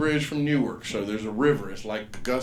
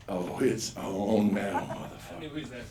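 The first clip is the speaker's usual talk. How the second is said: mock challenge; much slower than usual. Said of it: "I was like".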